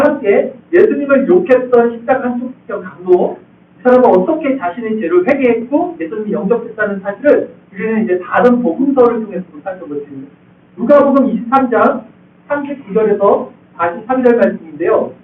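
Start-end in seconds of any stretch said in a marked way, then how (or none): none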